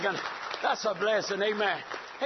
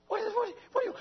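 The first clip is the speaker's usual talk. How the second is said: puzzled; 12 semitones higher, much faster than usual. Retold or imitated. Preacher's puzzled reply retold.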